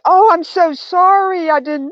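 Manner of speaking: mock an anxious over-apology; over-apologetic. Business owner mocking a gushing apology to customers, high and fluttery. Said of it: "I didn't say".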